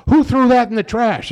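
high, loud accusing question; mock indignant demand. [name] to the patient about the trash, loud indignant demand. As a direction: demand an answer, outraged.

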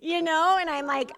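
ordinary talk

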